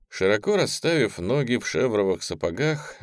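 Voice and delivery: usual speaking voice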